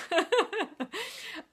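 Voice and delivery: a burst of high, loud laughter. Real, not acted. Coach cracking up at the hormone name, high loud laugh. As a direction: let a real laugh break out up high. Laughs at own tongue-twister.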